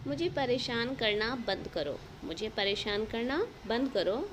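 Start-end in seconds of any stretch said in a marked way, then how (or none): none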